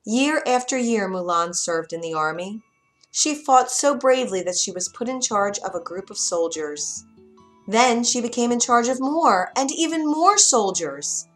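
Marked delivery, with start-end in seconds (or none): none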